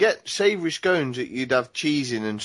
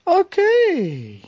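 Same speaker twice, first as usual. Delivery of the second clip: much higher, drawn-out falling okay; a goofy high-pitched okay that sounds high as a kite; squeaky high okay; 5 dB louder than usual. Real, not acted. Friend answers a greeting in a squeaky high voice. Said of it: "You sound like you're high as a kite".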